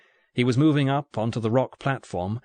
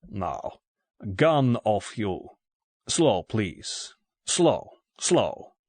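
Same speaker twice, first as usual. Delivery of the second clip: put-on slow, careful Russian-accented order; thick Russian accent; much slower than usual. A narrator's reading. Russian gunman disarming Bond, thick Russian accent. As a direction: draw out each word, heavily Russian-accented. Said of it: "in a thick Russian accent".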